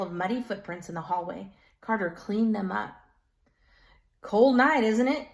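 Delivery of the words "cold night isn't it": put-on loud, high old woman with thick Polish accent. A narrator's reading. Miss Zaluski greeting Carter, thick Polish accent. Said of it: "in her thick Polish accent".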